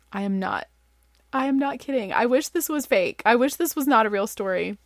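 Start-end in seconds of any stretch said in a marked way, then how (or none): none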